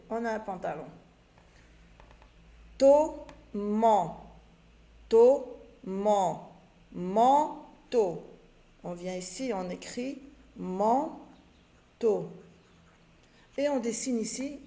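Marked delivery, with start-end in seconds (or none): 2.8-8.2 toman, toman, manteau called out loud; syllables called loud. Shown as a demonstration. Teacher calling out syllables then the word manteau, loud. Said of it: "reading syllables to pupils". 9.5-12.3 manteau spoken very slowly while writing; slow dictation. Shown as a demonstration. Teacher writing manteau for pupils, slow dictation. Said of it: "dictating while writing".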